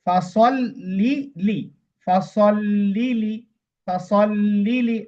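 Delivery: ফাসল্লি লি said slowly with the লাম sounded three times, the first held double; doubled lam. Shown as a demonstration. Teacher demonstrates the doubled lam to students, slowly. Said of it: "তাহলে কিভাবে পড়বো?".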